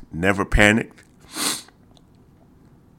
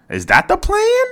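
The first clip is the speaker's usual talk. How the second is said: sudden very high disbelieving question; incredulous squeak; exasperated. Real, not acted. Host questioning the coach's plan, incredulous and high.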